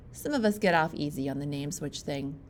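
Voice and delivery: usual speaking voice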